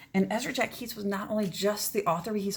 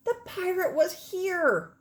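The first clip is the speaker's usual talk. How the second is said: ominous; 9 semitones higher, 4 dB louder, slower than usual. Acted. Reading the ominous note.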